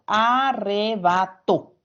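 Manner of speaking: Arrebato said slowly, syllable by syllable, TO stressed; a-rre-ba-TO dictated slowly. Shown as a demonstration. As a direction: drag out Arrebato for the class. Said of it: "Se carga la voz en la última".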